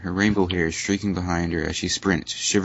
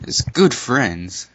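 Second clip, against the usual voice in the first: slow, louder, higher Twilight opening; formal Twilight voice; 5 semitones higher, 5 dB louder, much slower than usual. Acted. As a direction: drag out the speech like a lecture. Reading Twilight's speech.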